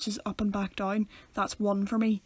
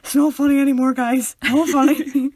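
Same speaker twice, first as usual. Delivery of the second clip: loud, higher mock complaint; mock pleading protest; 4 semitones higher, 10 dB louder than usual. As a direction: beg them to stop being funny. Acting out breathless listener begging podcasters.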